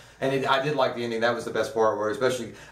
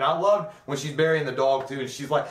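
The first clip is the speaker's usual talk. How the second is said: normal talking voice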